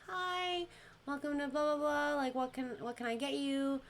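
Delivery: high, sing-song phone greeting; customer service voice. Retold or imitated. Restaurant worker answering a customer's call, customer service voice. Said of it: "customer service voice".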